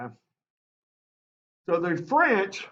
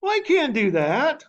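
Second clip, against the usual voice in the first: higher, louder mock refusal; mock British refusal; 9 semitones higher, 4 dB louder than usual. British government refusing the governor, higher mock refusal. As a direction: toss it off like a haughty official. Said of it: "British government says".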